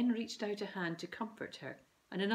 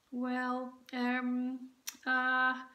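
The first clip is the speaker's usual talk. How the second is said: stalling, a little higher; hesitant boy; 6 semitones higher, 4 dB louder, much slower than usual. A narrator's reading. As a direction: hunt for words, stalling. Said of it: "Ben desperately searched for a way out".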